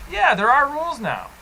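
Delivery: grinning through the words; smiling. Real, not acted. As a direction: say it with a smile. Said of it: "Why are you smiling when you say rules?".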